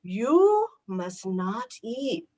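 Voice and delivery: lightly voiced German doctor, slow; a hint of the German doctor, slowly; German accent. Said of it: "He then says".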